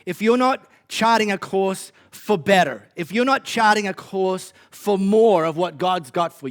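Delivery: building sermon delivery, forceful; preaching. Real, not acted. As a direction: preach it with rising conviction.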